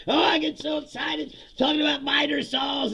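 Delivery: high, loud mock enthusiasm; over-excited presenter. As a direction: gush like a pumped-up stage host. Mimicking man jumping on stage.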